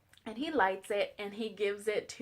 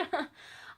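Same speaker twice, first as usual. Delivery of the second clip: quick breathy chuckle; brief self-conscious laugh; short laugh; 7 semitones higher, 4 dB quieter than usual. Real, not acted. Preacher admits she talks fast, quick chuckle.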